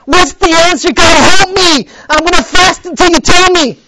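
high, loud pleading cry; desperate prayer. Retold or imitated. Preacher recalling begging God, high and loud. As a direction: cry out to God at the end of your rope. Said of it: "It's like me".

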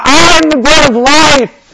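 high, booming, slow declaration; God's proclamation. Shown as a demonstration. Showing how God would say it, loud proclamation. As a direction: proclaim it at full volume, drawn out. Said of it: "imagine God saying that".

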